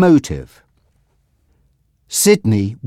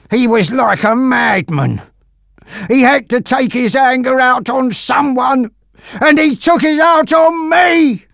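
high, loud agitated outburst; worked-up cry, climbing in pitch and volume; trembling, upset voice; 13 semitones higher, 5 dB louder than usual. A narrator's reading. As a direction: build from agitation to a pained shout. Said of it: "his voice trembling".

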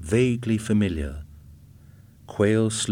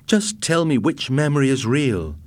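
mildly desperate, raised-pitch appeal; desperate plea; 6 semitones higher, 4 dB louder than usual. A narrator's reading. Husband begs departing wife for the truth, desperate. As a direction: beg for an answer, voice lifted. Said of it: "he called out desperately".

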